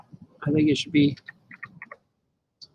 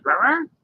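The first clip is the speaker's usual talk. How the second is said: two playful growls, a bit higher; wolf boy growl; 7 semitones higher, 3 dB louder than usual. Acted. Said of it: "He goes".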